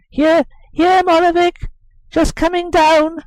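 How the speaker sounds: put-on boy calling down to his nurse; boy's voice. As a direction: call down from the wall as a young boy. Boy calling back from the wall.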